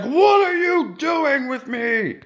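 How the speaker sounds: high, slow mock shout; mock woman. Mock patient shouting at medics, high. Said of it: "she was like".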